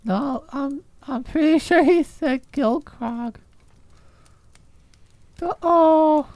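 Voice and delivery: put-on low, soft drunken mumble; drunk mumbling. Drunk gnome mumbling sadly.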